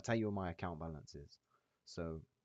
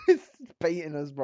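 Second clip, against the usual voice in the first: laughing; 10 semitones higher, 14 dB louder than usual. Real, not acted. Laughs at market baiting.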